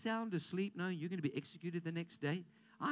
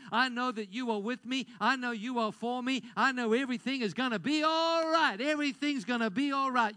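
louder, rising preacher's build to the congregation; full-force preaching; 4 semitones higher, 5 dB louder than usual. Acted. Pastor rallying the congregation, full-force preaching. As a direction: drive it home to the church like a Sunday climax.

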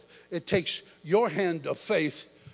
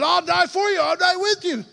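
high, loud boastful promise; loud vow; 11 semitones higher, 7 dB louder than usual. Retold or imitated. Peter loudly vowing to Jesus, high and boastful. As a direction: proclaim it big and brave.

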